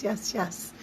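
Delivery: ordinary delivery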